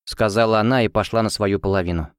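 ordinary delivery, nothing marked

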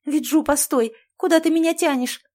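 loud, high exclamation; exclaiming. Acted. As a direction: call out to stop him. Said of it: "воскликнула Наташа".

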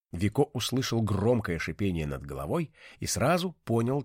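ordinary delivery

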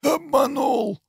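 high, fading gurgle through blood; gurgling. Acted. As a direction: gasp it out, drowning in blood. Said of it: "пробулькал он".

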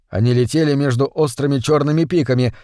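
ordinary delivery, nothing marked

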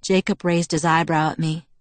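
plain reading voice

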